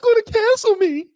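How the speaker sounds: very high falsetto mock pleading; mock scaredy-cat. Host mocking people afraid of being cancelled, squeaky pleading voice. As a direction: plead in a squeaky, hammed-up panic. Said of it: "people out here who are so".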